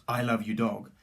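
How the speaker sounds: a touch of a retold aggressive yell; mild mock shout. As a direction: imitate the angry shout, only half loud. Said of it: "he switches to a very aggressive tone of voice as he shouts".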